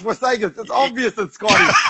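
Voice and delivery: high-pitched outraged protest, jokingly; indignant protest. Real, not acted. Co-host protests the unfair points, pitched high in outrage. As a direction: squeal it out in mock outrage at the points.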